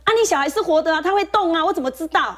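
flustered retort. Retold or imitated. Young nurse flustered, snapping back at the angry parent. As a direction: blurt it out like a rattled young nurse. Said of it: "那我那个学妹呢也很急".

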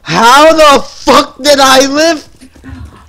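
loud, slow, drawn-out yell; disbelieving shout. Real, not acted. Survived a fight, stunned.

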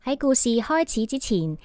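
ordinary delivery, nothing marked

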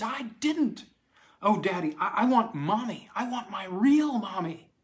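put-on a little girl's sob climbing to a cry; sobbing child, rising.

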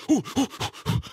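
soft high ouh ouh, quaking; frightened whimpers at God's voice; mock frightened hooting. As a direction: mimic shivering terror. Imitated trembling fear sounds.

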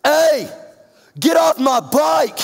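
high, loud angry yell; man yelling. Retold or imitated. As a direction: shout at a kid riding off with your bike. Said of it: "I hear this man yell".

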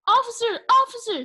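high, urgent call; shouting. A narrator's reading. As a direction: shout to get the officer's attention. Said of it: "shouted Baby".

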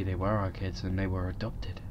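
soft, breathy storyteller whisper; spooky whisper. Pretend. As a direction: whisper it like a creepy tale. Spooky narration of invented plot.

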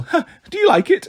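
high nervous fake laugh; forced giggle. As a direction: giggle weakly to cover fear. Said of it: "Grover forced a weak giggle".

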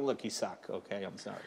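low, very soft mock rejection; hushed, sheepish pretend apology; apologetic letdown. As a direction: break it to them under your breath. Imagined apologetic rejection of contestant.

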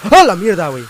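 sudden high, loud burst of alarm; startled yell. Real, not acted. Player jumped by a creature in the game, startled yell. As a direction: yelp and curse as if jumped.

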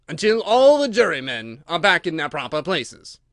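loud, solemn declaration; pompous, grave royal ruling. A narrator's reading. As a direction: lay down the condition with weight. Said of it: "in a very grave voice".

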